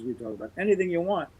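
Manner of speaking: ordinary talk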